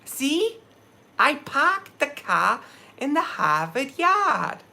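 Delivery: high, slow mock Boston accent. Outsiders mocking his New England roots, high slow Boston accent. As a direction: drop every R like an outsider faking Boston. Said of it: "find out I'm from New England".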